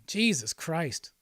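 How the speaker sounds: ordinary talk, nothing marked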